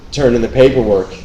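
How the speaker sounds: ordinary talk, nothing marked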